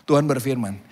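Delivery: usual speaking voice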